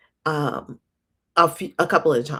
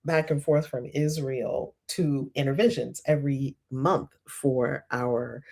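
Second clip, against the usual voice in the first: normal talking voice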